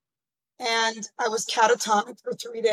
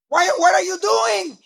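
nagging parents; 8 semitones higher than usual. Retold or imitated. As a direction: imitate worried parents nagging their daughter. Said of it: "my parents would be like".

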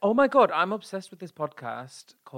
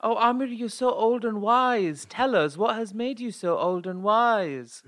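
slow, high mock awe; mock reverent; 6 semitones higher, slower than usual. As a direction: gush over his wisdom teasingly. Mock reverent praise of husband.

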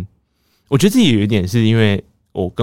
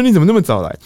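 a higher, brighter question put on as if nothing happened; lightly acted casual surprise; fake innocent; 10 semitones higher, 5 dB louder, faster than usual. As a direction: act surprised to see mom. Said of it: "还是边假装".

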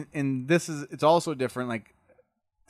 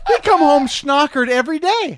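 normal talking voice

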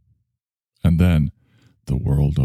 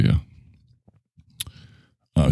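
ordinary talk, nothing marked

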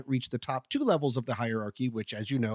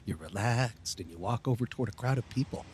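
guided meditation; 4 semitones lower, 4 dB quieter than usual. Acted. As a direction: lull him into calm, softly. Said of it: "one of my famous guided meditations".

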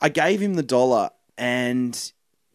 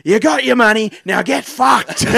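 loud, high telling-off, slowing at the end; hammed-up furious dismissal; retold angry outburst; 8 semitones higher, 7 dB louder, slower than usual. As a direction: send him packing, drawing out the last words. Timid woman's outburst, retold.

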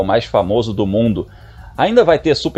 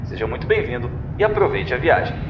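airline captain's PA announcement; pilot cabin announcement; 5 dB quieter than usual. Acted. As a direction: announce it calmly like a pilot over the intercom. Podcast opens as pilot announcement.

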